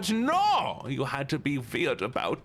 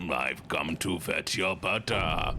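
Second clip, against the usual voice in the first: creepy intruder; 7 semitones lower, slower than usual. Pretend. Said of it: "I'm like".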